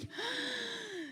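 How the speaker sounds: sharp, airy gasp of shock; sudden horrified intake of breath. Retold or imitated. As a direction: gasp as if hit by bad news. Shocked reaction retold.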